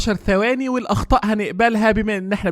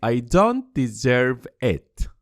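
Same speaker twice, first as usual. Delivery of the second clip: slow model phrase; 5 semitones lower, much slower than usual. Shown as a demonstration. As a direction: drill it gently for students. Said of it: "يا ترى إزاي نقول". (نطق بطيء جدا وهادئ)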